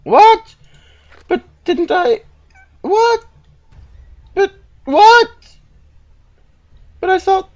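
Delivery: very high, loud squeals of disbelief; shocked disbelief. Real, not acted. Player finds his weapon choice is wrong, shocked. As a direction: squeak each word out, utterly baffled.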